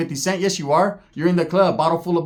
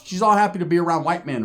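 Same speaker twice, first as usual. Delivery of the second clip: usual speaking voice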